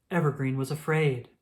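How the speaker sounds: ordinary delivery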